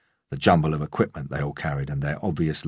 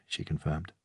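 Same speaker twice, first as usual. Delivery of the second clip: plain reading voice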